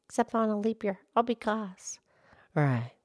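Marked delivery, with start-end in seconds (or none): 2.5-2.9 much lower, gentle cow voice; Mildred the cow. Acted. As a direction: drop the pitch low to play the old cow. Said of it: "Mildred the Moo Moo Cow".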